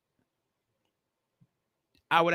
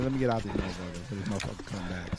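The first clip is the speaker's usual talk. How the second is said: fast hushed; 7 semitones lower, 10 dB quieter, faster than usual. Retold or imitated. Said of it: "He said".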